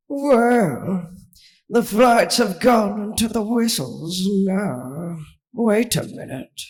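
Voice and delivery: a slow, sly sea creature; dolphin voice. A narrator's reading. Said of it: "the dolphin said".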